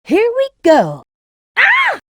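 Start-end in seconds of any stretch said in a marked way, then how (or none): 1.5-2.0 high sharp cry of surprise; high startled yelp; surprised yelp. Acted. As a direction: yelp at a sudden shock. Sudden surprise in game.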